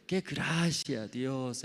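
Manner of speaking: slow, gentle and unbothered; calm grateful pastor. Retold or imitated. Preacher retelling the pastor's calm thanks after a fall. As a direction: savour the words like a man at peace. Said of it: "así dice".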